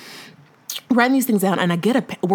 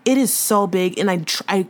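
ordinary talk

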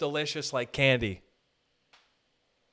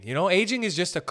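ordinary delivery

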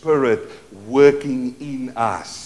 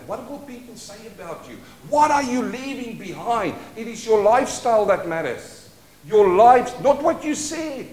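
higher and building in force; a voice climbing toward the point; rising preacher's fervor; 7 semitones higher than usual. Real, not acted. Preacher urging the church about their legacy, rising fervor.

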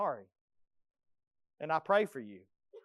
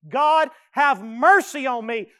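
slow, loud, anguished plea for mercy; pleading prayer; 5 semitones higher, 10 dB louder than usual. Acted. Preacher cries out David's plea, slow and pleading. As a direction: beg God like a broken sinner.

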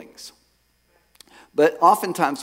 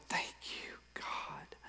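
very soft, voiceless prayer; whispered thanks; 22 dB quieter, much slower than usual. Retold or imitated. Man thanking God as the weight lifts, whispered. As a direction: breathe out your gratitude in a whisper.

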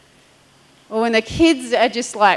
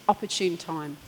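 very soft, low, hushed prompting; gentle hushed reminder; quiet divine voice; 5 semitones lower, 14 dB quieter than usual. Retold or imitated. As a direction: murmur it like a still small voice. Holy Spirit speaks quietly.

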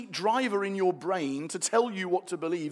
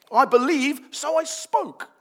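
louder, higher scripture proclamation; proclaiming; 8 semitones higher, 8 dB louder, slower than usual. Shown as a demonstration. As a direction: ring it out as a declaration.

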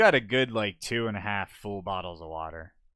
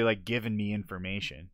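ordinary talk, nothing marked

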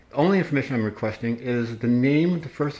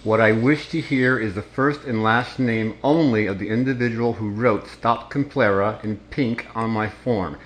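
firm, slow, every word separate; slow and deliberate. Real, not acted. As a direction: spell it out with stern patience. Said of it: "I am going to ask you again".

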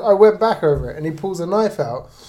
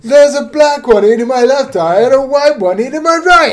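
mock victim shout; 7 semitones higher, 6 dB louder than usual. Riffing on shouted deaths.

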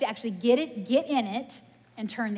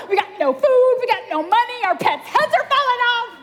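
high, loud, laughing frantic movie voice; comic frantic whine; movie quote impression; 13 semitones higher, 10 dB louder than usual. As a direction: wail it like a hammed-up movie meltdown. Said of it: "I felt like Dumb and Dumber".

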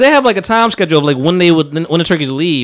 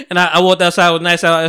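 ordinary delivery

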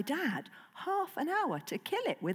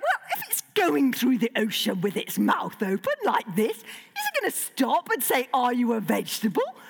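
animated, mock-incredulous comeback; incredulous retort. Child to dad about the basking shark, mock-incredulous. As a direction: argue it back with hammed-up disbelief.

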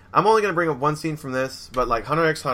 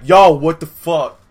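loud, slow, higher mock outrage; indignant outburst; 4 semitones higher, 11 dB louder, slower than usual. Sister confronts her brother in a retold scene, loud indignant outburst. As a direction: blurt it out in imitated outrage, drawn out.

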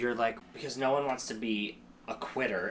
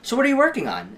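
much higher, louder, loaded ask; pointed probing; 10 semitones higher, 8 dB louder, faster than usual. Retold or imitated. Sizing up a fellow actor, pointed and loaded. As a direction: press them on their career status. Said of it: "such a subtle difference".

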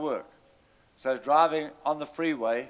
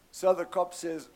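ordinary delivery

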